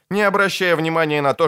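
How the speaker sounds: plain reading voice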